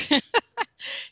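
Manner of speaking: a high, open burst of laughter; high delighted laugh; bright laugh. Real, not acted. Laughs at her own story.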